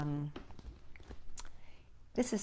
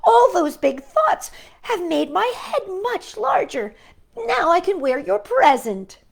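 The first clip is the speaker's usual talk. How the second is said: high, loud and gleeful with a laugh; bursting with happy news; excited Toad; 10 semitones higher, 8 dB louder than usual. A narrator's reading. Toad telling Frog about his new hat, excited and laughing. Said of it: "he cried".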